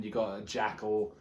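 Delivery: ordinary talk, nothing marked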